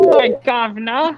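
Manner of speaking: loud mock-Cockney greeting; London accent. Shown as a demonstration. Friend joining the English accent attempts, loud mock London accent. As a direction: belt it out like a cartoon Londoner. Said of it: "could I do an English accent".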